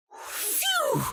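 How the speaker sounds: very high exaggerated whistle of relief; cartoon sigh of relief; big relieved phew. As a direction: blow out relief like you just dodged a bullet. Relief after dodging awkward question.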